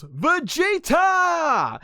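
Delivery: high, long drawn-out mock scream; hammed-up high-pitched shout; imitated yell. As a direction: yell the name as a furious cartoon wife, stretching it out. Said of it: "she yells".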